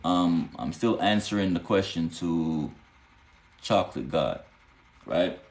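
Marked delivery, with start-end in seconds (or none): none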